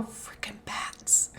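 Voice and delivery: slow breathy whisper under the breath. Retold or imitated. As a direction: mutter it to yourself, unvoiced and slow. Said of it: "I just randomly hear this girl go".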